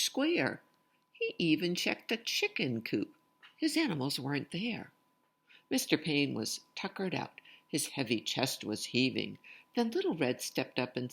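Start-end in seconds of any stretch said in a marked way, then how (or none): none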